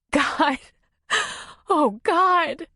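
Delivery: high, distraught wail; sobbing. A narrator's reading. Nora breaks down after the bear attack, sobbing. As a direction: sob it out in shock, high-pitched. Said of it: "put her hands over her face and sobbed".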